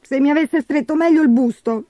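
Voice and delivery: high, put-on snivelling voice; self-pitying high-pitched moan; whimpering stepsister. A narrator's reading. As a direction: whine like a spoilt crybaby. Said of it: "piagnucolò Gertrude".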